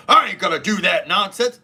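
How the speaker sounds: hammed-up aggressive tough-guy retort; aggressive manly voice. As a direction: imitate someone suddenly acting tough and manly. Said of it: "get very aggressive and manly and go".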